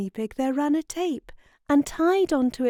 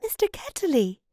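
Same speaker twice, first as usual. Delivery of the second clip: restrained high-pitched exclamation; a girl's mild narrated shout of recognition; 8 semitones higher than usual. A narrator's reading. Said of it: "she shouted out".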